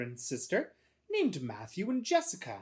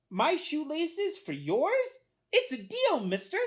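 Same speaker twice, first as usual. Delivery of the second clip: high, slow question, then agreement; eager little boy; 14 semitones higher, 5 dB louder, slower than usual. A narrator's reading. Boy accepting a salesman's swap, high eager voice. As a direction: agree to a trade in a child's high voice.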